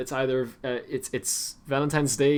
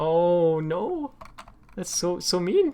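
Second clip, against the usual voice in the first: drawn-out, pitched-up lament; mock dismay; 5 semitones higher, much slower than usual. Streamer reacting to a mean meme, mock dismay. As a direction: lament it in playful horror.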